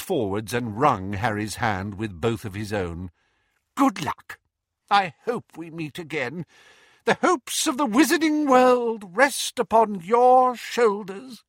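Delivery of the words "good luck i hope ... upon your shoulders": high-pitched, grandly solemn farewell; earnest wizard. A narrator's reading. Diggle wishing Harry luck as he leaves, high and fervent. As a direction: proclaim it in a high, fervent character voice.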